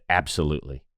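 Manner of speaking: low, soft, understated certainty; quiet confidence. Retold or imitated. Player asked if he could manage tomorrow, quietly confident. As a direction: imitate someone calmly sure of himself. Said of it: "he had the confidence".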